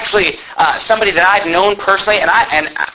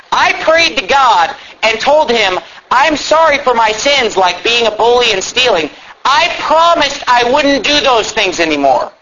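higher, deliberate, heavily emphasized reading; pointed reading; 5 semitones higher than usual. Retold or imitated. Preacher quoting the tract's false gospel, pointed emphasis. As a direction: lay out each phrase for scrutiny.

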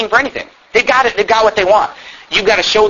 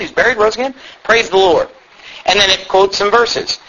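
ordinary talk

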